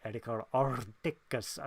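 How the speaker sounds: soft, low Puerto Rican pronunciation of the name; Puerto Rican accent. Retold or imitated. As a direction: imitate a rich Puerto Rican lilt. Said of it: "says it in the most Puerto Rican way possible".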